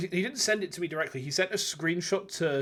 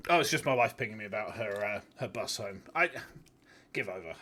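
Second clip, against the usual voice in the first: ordinary talk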